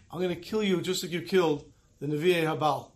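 usual speaking voice

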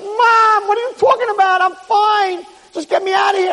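high-pitched frantic insistence; exasperated protest. Acted. Son protests to wailing mother.

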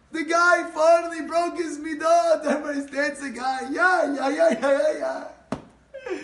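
high, loud cheering voice; loud jubilant outburst; excited crowd uproar. Retold or imitated. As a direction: cry out like an amazed congregation going wild. Congregation going crazy with excitement.

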